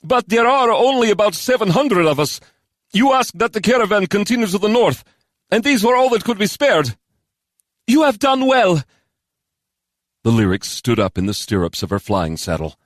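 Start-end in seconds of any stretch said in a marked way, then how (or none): 0.0-7.0 high, anxious character voice; high-pitched, uneasy subordinate; nervous Georg. Acted. 7.9-8.8 high, loud character voice; high-pitched, raised commander's voice; approving commander. Acted. Commander answers her nervous officer.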